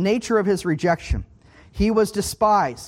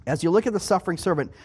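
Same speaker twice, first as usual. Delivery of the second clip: ordinary talk, nothing marked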